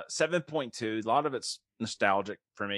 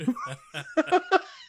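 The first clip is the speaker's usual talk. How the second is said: high laugh. Real, not acted. Laughs at own impression.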